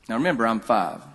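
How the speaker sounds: ordinary delivery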